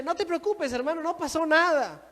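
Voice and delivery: high, airy brush-off of an apology; lip-service forgiveness. Acted. Believer waving away an apology, insincerely forgiving. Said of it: "Pero eso es solo de labios".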